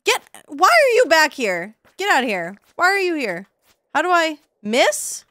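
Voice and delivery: high, loud exasperated shouting at an enemy; frustrated yelling. Real, not acted. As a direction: yell at the monster to go away. Monster keeps coming back.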